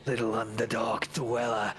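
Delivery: low threatening monster voice; menacing brute. Acted. Enemy drake-rider threatens the dark elf, low and menacing.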